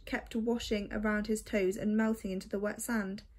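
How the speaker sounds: ordinary delivery, nothing marked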